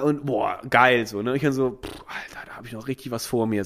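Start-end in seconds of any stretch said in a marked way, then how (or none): 1.8-3.6 breathy whisper to himself; hushed inner aside; whispered thought. Retold or imitated. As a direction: whisper it under your breath. Retold private thought, unvoiced.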